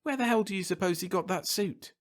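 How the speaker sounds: high, incredulous question; incredulous exclamation. A narrator's reading. TV man wondering about the stranger's suit, high incredulous exclamation. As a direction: pitch it way up in amused disbelief.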